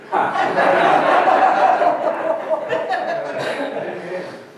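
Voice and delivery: breathless wheezy laughter; long wheezing laugh. Real, not acted. Preacher cracking up at the insult story, wheezing laugh. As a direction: laugh until the breath runs out.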